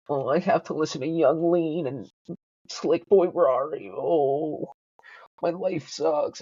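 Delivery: high, slow mopey whine; whiny depressed mope. Retold or imitated. Mocking himself to listeners, high whiny mope. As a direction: sulk it out like a sad emo kid. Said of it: "This is me being, being a pussy".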